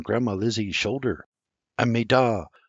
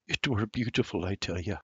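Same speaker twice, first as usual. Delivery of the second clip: much softer, choked-up murmur; 3 semitones lower, 9 dB quieter than usual. A narrator's reading. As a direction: let it fade to almost nothing. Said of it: "Senior murmurs".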